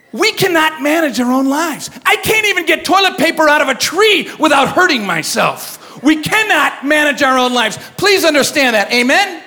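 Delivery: rally the crowd from the pulpit; preacher firing up the youth; preaching. Acted.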